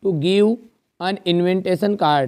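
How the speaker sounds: each word drawn out slowly for copying; slow dictation. Shown as a demonstration. Teacher dictating an invitation letter to the class, very slow. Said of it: "dictating letter slowly".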